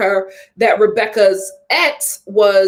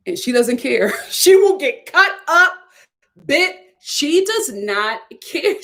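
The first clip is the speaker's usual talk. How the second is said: ordinary talk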